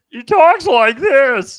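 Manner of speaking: high, loud, slow goofy drawl; Barney impression. Shown as a demonstration. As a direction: stretch it out like a dopey cartoon drunk. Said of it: "talks like Barney from The Simpsons".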